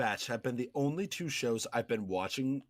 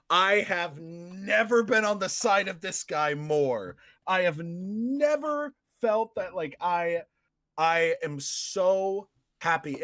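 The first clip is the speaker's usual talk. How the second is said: slow, drawn-out stress on "never" and "so"; emphatic retold reaction; 4 semitones higher, slower than usual. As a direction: stretch "never" higher for emphasis. Retelling own thrilled reaction.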